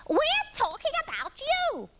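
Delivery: high, loud parrot call. A narrator's reading. Parrot answering Iggy below, high drawn-out call. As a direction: call it down from a branch. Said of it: "called Parrot from her branch".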